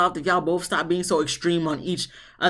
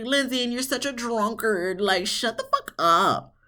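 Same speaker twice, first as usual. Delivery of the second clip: sassy retort; 6 semitones higher than usual. Retold or imitated. Retorting at Lindsay on TV.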